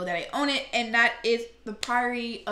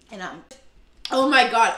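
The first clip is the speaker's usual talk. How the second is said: coughing, choking on dust; choked up by dust, gasping; 11 dB louder than usual. Real, not acted. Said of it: "I'm literally choking".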